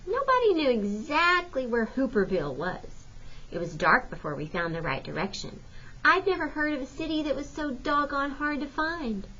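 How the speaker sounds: plain reading voice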